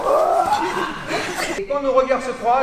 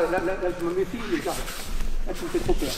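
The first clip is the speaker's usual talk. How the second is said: mock fear; 4 semitones lower, 5 dB quieter, faster than usual. Coach jokes a student scares him.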